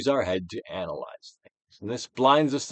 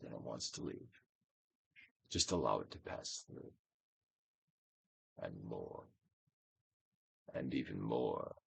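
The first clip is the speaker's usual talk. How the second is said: guided meditation; 4 dB louder than usual. Acted. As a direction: coax the listener to let feelings pass.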